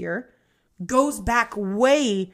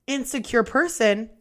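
ordinary delivery

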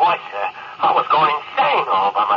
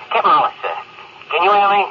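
raised, higher call into the radio; calling over radio; 7 semitones higher, faster than usual. Acted. Crewman calling his captain over a faint radio, raised and urgent. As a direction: push the voice out to reach him.

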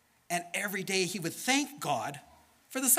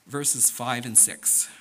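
ordinary delivery, nothing marked

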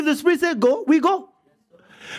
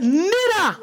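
slow, tender voice of Jesus; 4 semitones higher, slower than usual. Retold or imitated. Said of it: "He said".

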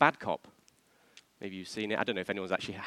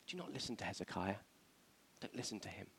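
soft, lower warning; hushed warning; 4 semitones lower, 15 dB quieter, faster than usual. Acted. Envoy warning the city off Hezekiah, hushed. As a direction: warn them off quietly.

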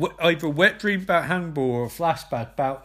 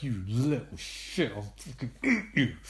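quiet menace; 6 semitones lower, 6 dB quieter than usual. Acted. Hannibal glares at a girl, quietly menacing. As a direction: threaten under your breath, pitched low. Hannibal's look voiced as threat.

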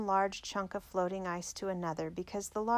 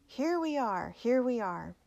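restrained storybook shout, raised pitch easing down; 4 semitones higher, 3 dB louder than usual. A narrator's reading. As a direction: call out to rescuers, mildly. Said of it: "and shouted".

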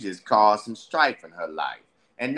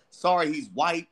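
usual speaking voice

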